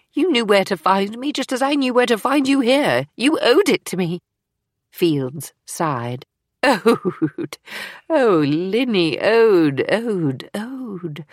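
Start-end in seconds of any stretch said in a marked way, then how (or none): 0.1-4.2 higher-pitched, insistent reproach; heated, aggrieved demand; accusing. Acted. Eleanor accuses Fields of failing her. 6.6-11.2 drawn-out, falling repeats that fade in volume; exasperated, savouring the word bitterly; incredulous echo. Acted. Fields throws the accused word back.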